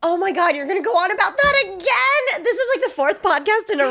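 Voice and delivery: high-pitched exasperation; exasperated protest. Real, not acted. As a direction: pitch shoots up in disbelief. Exasperated at co-host repeating gossip.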